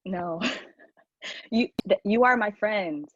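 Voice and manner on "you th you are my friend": ordinary delivery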